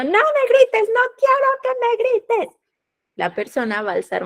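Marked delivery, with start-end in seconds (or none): 0.0-2.6 put-on much higher, pleading hurt-child voice; wounded child. Adult reacting from the wounded inner child, much higher and whiny. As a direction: whine it like a hurt little kid. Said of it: "desde ese niño herido diciendo".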